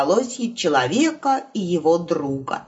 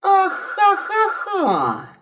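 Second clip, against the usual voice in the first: high slow vengeful cackle; 12 semitones higher, much slower than usual. Acted. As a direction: cackle with revenge. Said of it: "мстительно подумал волк". (мстительный хохот)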